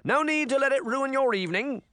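high, toothy smile in the voice; smooth it over for the guests with a big grin; high-pitched, smiling reassurance; Prince Charming. A narrator's reading. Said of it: "said Charming with his toothy smile".

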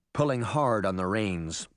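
plain reading voice

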